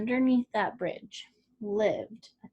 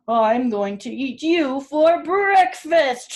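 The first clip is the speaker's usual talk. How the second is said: menacing troll; 3 semitones higher, 10 dB louder, slower than usual. A narrator's reading. Troll threatens goat.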